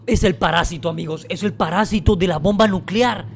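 normal talking voice

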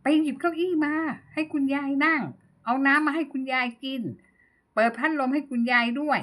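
lightly voiced old mother fussing over a guest; mother calling to daughter. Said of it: "เรียกลูกสาว".

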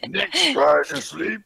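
rising into a hammed-up angry yell; angry husband. Husband yelling at crying wife, hammed-up anger. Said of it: "how do I do this in this voice?".

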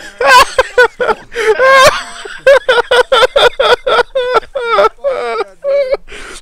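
loud, high helpless laughter, real; howling laughter. Real, not acted. Friend cracking up at the frosted tips detail.

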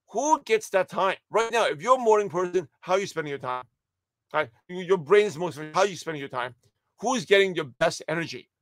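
ordinary talk, nothing marked

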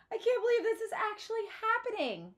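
high, loud and thrilled; overjoyed. A narrator's reading. Girl to her father, thrilled about the gift. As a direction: burst with disbelieving joy.

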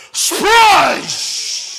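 long, loud high splash; imitated splash. Storyteller mimics dog hitting the septic tank, drawn-out shouted sound. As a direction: stretch the word out like the noise itself.

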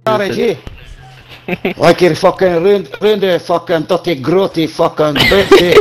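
hammed-up, abusive Scottish accent. Asked to go Scottish, insulting the listener in a Scottish accent. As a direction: trade insults as a mock Scot. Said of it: "Goes when you're Scottish".

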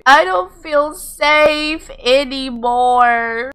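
high, slow, drawn-out complaint; dramatic unease. Pretend. Player watching odd visitor approach, hammed-up unease. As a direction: stretch it out high, playing up the worry.

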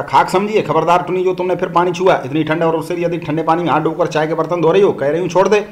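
plain reading voice

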